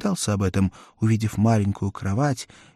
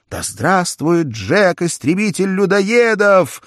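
high, loud, slow cheer; joyful cheering; 8 semitones higher, 5 dB louder than usual. A narrator's reading. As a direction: hail the hero together. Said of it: "радостно кричали они". (радостные возгласы)

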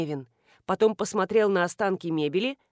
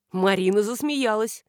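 ordinary delivery

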